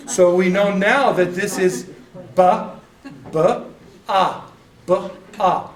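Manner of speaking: ba, ah spoken loud and deliberate, syllable by syllable; sounding out ba ah. Shown as a demonstration. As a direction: model ba and ah clearly for the class. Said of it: "So that's how you would say that".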